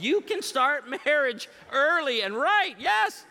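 high, loud revival-preacher delivery; hammed-up preaching. Comic preacher urging young couples to marry cheaply, hammed-up preaching. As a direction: drive it home to the crowd, pitched high.

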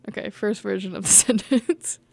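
real giggle breaking into the words; laughing. Real, not acted. Reader announcing a retake to the editor, laughing. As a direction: start laughing as you finish the phrase.

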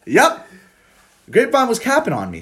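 high, loud indignant complaint; mock outrage. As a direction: play it up indignantly.

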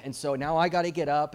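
usual speaking voice

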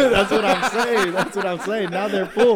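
laughing agreement. Real, not acted. Laughing agreement about shelves.

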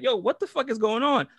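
high-pitched acted outcry; imitating everybody demanding answers; retold crowd alarm. Voices the whole club reacting.

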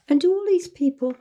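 normal talking voice

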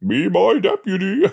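lightly hammed-up high voice; character voice. Game player reading a character's line, high put-on voice.

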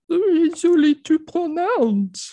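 put-on high, slow, whiny French accent; a whiny, Kermit-like French voice; French Jordan Peterson. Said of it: "I was trying to do French Jordan Peterson".

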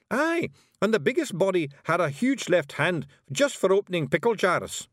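high Scots voice telling a tall tale; boastful Scottish Feegle. A narrator's reading. Rob telling Miss Level of raiding adventures, boastful. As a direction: brag away, pitched well up, in Scots.

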